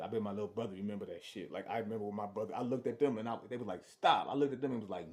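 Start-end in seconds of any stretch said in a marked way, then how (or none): 3.8-4.3 loud sharp command; shouted stop. Retold or imitated. Cop shouting at him to stop, loud command. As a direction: yell it like a cop. Cops yelling stop again.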